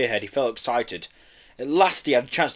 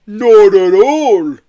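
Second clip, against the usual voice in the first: slow, louder far-off creature protest; protesting Yeti; 8 semitones higher, 14 dB louder, much slower than usual. A narrator's reading. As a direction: insist like a tipsy Yeti. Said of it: "protested Marbo".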